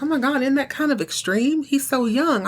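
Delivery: a touch of a mother's dismay; shocked mom. Retold or imitated. Karen hearing her son will be suspended.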